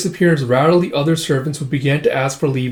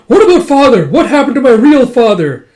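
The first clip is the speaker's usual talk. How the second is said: high, loud, distraught demand; retold scream; 10 semitones higher, 9 dB louder than usual. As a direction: scream the question, playing desperate. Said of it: "they just screams".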